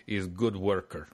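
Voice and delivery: low mock Russian accent. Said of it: "worst Yakov Smirnov joke".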